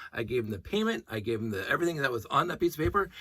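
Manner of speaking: ordinary delivery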